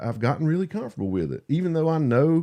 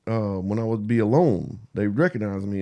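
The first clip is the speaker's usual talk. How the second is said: ordinary talk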